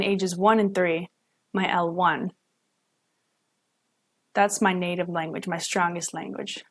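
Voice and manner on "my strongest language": usual speaking voice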